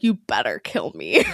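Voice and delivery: imitating a low, defiant challenge; mock defiant dare. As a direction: drop the voice low and dare them. Quoting Ron's defiance.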